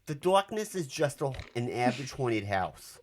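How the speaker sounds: reading a review in a hammed-up New York accent. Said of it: "Do a New York accent".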